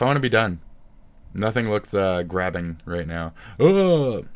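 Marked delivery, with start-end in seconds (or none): 3.6-4.3 loud, high groan of disgust; exasperated groan. Real, not acted. Streamer finds nothing grabbing to watch, groaning loudly. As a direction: let out a big fed-up "ugh".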